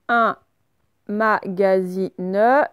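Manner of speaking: slow, drawn-out dictation; dictating syllable by syllable. Shown as a demonstration. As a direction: stretch the word for pupils to write. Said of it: "dictating word to class".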